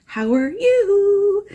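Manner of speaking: very high, slow sing-song greeting. Pretend. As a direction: stretch it out way up high. Greeting viewers, drawn-out high take.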